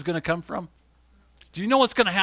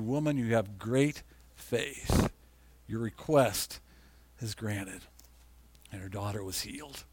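tender; 6 semitones lower, 5 dB quieter, slower than usual. Acted. Jesus's tender reply, read softly.

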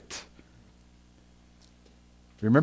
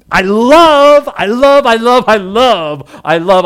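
high, loud, delighted outburst; excited preacher repeating himself; thrilled; 8 semitones higher, 10 dB louder, slower than usual. Real, not acted. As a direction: burst out with real joy at the detail.

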